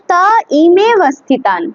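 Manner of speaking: তা ইমে split into separate parts; broken in two. Shown as a demonstration. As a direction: break it into two pieces. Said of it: "দুটোকে ভাঙতে".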